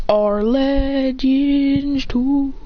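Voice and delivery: very slow, held-out chant-like call; attack call. Acted. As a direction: stretch every syllable as the attack builds. Character invokes attack.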